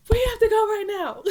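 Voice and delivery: very high, loud outburst; snapped yell. Retold or imitated. Wife banging on things at her husband, snapped yell. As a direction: re-enact snapping, shrieked at the top of the voice. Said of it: "I just snapped".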